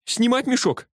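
very high, urgent question; calling out. A narrator's reading. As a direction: shout a question to the front. Asks driver across the car.

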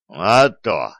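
proud, slightly emphatic retort; с гордостью. A narrator's reading. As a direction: answer with understated pride. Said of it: "гордо ответил Раду". (с гордостью, веско)

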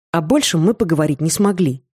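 ordinary delivery

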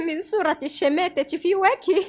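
ordinary talk

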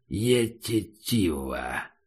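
low, very slow creature rumble; monster grumble. Acted. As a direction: mumble it like a dumb beast. Monster mangles a word.

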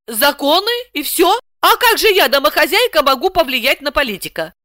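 high-pitched, dismayed questioning; bewildered. A narrator's reading. Housewife at a loss how to influence politician, bewildered. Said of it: "Александра пришла в растерянность".

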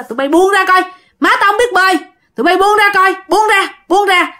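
high, repeated demands to let go; urgent protective son. Acted. Son pushes the river children away from mother, urgent and high.

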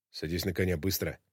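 low, soft, crisp command; hushed but precise order; quiet firm order. Acted. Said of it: "Так же тихо, но чётко приказал он".

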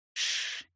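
soft unvoiced hushing hiss; interrupting shush. A narrator's reading. Heroine interrupts him mid-advance, shushing. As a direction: shush them, cutting in. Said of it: "перебила я".

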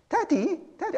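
put-on high, soft British little-kid voice; British child. Child asking a parent for a treat, high British kid voice. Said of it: "I don't know why he has to be British".

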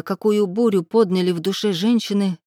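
ordinary delivery, nothing marked

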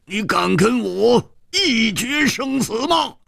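high, slow challenging shout; angry roar. A narrator's reading. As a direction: bellow the challenge at the villain. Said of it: "怒喝道".

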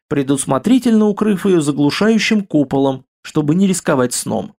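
ordinary delivery

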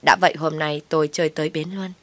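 plain reading voice

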